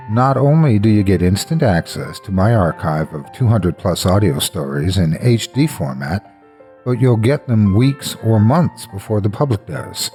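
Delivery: ordinary delivery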